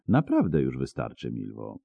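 low, soft appeal; quiet, composed voice; calming. A narrator's reading. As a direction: gently talk someone down. Vampire calms the angry archer.

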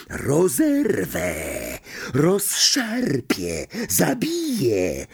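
very slow, high eerie menace; icy, high-pitched disembodied threat; murderous whisper. A narrator's reading. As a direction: draw out each word like a killer. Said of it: "morderczy szept".

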